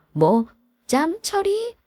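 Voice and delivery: slow rising 뭐, 짬처리? in disbelief; offended disbelief. Acted. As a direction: drag it out, stunned and insulted. Narrator offended by insult. (기가 막혀 높게 되묻기)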